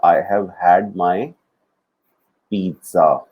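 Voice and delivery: each word drawn out slowly for the class; slow teaching demo. Shown as a demonstration. Teacher modelling a sentence for students, very slow. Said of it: "So ये वाला जो had है".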